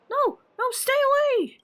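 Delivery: high panicked plea; frightened cry. Acted. Character begging the fire to stay away, high and scared. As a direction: shout at it to keep away.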